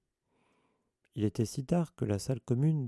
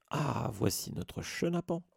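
higher, slow, beaming welcome; jovial vain teacher; 5 semitones higher than usual. Acted. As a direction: greet him like a proud showman. Lockhart greets Harry cheerfully.

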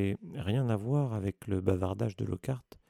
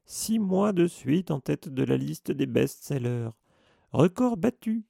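higher, louder, slow boast rising to a peak; bragging; 6 semitones higher, 6 dB louder, slower than usual. Acted. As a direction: crow over the record. Lockhart bragging about sales.

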